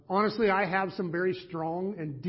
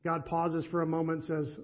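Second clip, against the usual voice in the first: ordinary delivery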